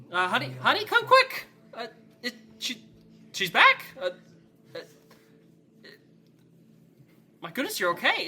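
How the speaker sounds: high, loud, breathless call for her husband; excited scream. Acted. A mother sees her lost daughter and calls her husband, loud and high. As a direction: shout for him in shocked joy. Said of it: "she screams out to her husband".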